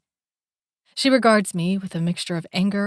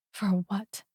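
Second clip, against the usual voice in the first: soft, hushed question; quiet; 6 dB quieter than usual. Acted. Woman answering Calum's apology, quiet and wary. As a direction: barely voice it, softer. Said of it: "she asks quietly".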